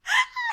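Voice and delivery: high burst of laughter. Real, not acted. Amused by cohost's joke.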